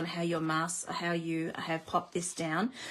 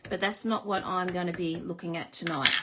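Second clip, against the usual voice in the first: normal talking voice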